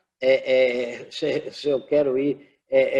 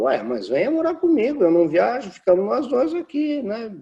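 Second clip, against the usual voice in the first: imitating grandma; 9 semitones higher, 4 dB louder than usual. Grandma voiced in story.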